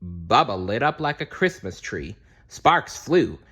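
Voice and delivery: ordinary delivery, nothing marked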